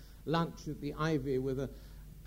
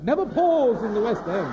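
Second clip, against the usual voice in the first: loud, grand moral with a laugh; Gielgud's punchline; 7 semitones higher, 11 dB louder than usual. Retold or imitated. Sir John ending his story, loud and grand. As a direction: deliver the rule as a triumphant coda. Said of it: "Gielgud's punchline".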